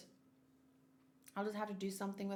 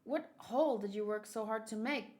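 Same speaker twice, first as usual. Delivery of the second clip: usual speaking voice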